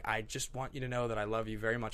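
low, soft, heartfelt; tender confession. Retold or imitated. Father telling his daughter he loves her, low and tender. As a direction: say it gently after a pause. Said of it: "And he just pauses".

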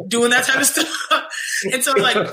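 ordinary talk, nothing marked

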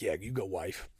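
low, soft aside backing his wife; retold quiet cheering-on.

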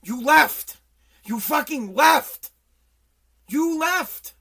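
loud repeated accusation, rising pitch; shouting an accusation over and over; angry accusation. Pretend. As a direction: hammer it home like a chant of blame. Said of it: "I could say".

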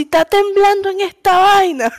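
high-pitched hammed-up panic; imitating a startled guy in a video; mock alarmed man. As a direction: squeal that it's shaking. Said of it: "de repente hace".

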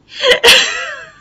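high, loud real laugh; burst of laughter. Real, not acted. Vlogger cracking up at the episode's ending, real laughter. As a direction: let a big laugh break out.

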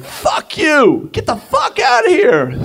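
high shouted imitation; imitating the stranger's high yell; angry stranger. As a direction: shriek it like the raging guy. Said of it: "And he's like".

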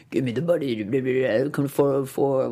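low, grainy mock caller voice. Host imitating the blackmailer's creepy call, low grainy voice. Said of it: "We get that grainy voice".

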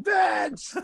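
high, calling out a name in imitation; mock scream. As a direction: yell the name like Johnny does. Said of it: "Johnny screaming to get his attention".

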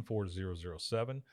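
ordinary talk, nothing marked

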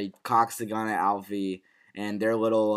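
ordinary talk

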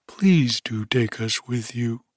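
ordinary delivery

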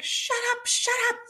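high fangirl squeal; squealing disbelief. Retold or imitated. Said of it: "I was like".

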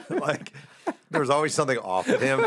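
laughing it out, voice jumping up; can't keep a straight face. Real, not acted. Recalling Dykstra's prank, laughing through it.

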